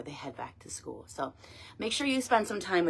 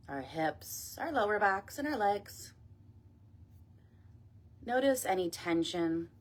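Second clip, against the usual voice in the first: guided meditation; 5 dB quieter than usual. Acted. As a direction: ease them gently down, phrase by phrase. Leads a body scan meditation.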